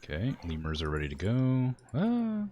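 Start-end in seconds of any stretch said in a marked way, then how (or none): 1.9-2.5 words stretched by a yawn. Real, not acted. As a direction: talk through a big yawn. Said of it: "I can't stop yawning".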